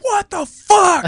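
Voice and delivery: high, slow, loud shocked cry; shocked outburst. Retold or imitated. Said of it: "be like".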